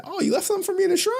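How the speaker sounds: very high, rapid eager squeal; high-pitched, speedy mock delight; mock excitement. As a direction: act overexcited, rattled off fast and high. Retells own eager reply to trolls.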